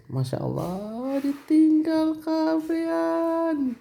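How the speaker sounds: slow, drawn-out high wail; keluhan melengking. Real, not acted. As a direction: stretch it out, climbing very high. Keluhan barang ditinggal semua. (keluhan panjang bernada tinggi)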